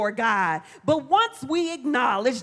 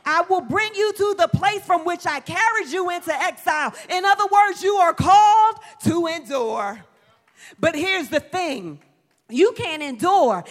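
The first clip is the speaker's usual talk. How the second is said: raised, ringing scripture proclamation; preaching; 3 semitones higher than usual. Acted. Preacher proclaims God's promise to the congregation, forceful preaching.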